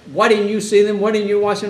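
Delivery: loud accusing cry at the caretaker; accusing anger. Retold or imitated. Grieving parents shouting blame over a drowned baby, angry. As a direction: hurl the blame.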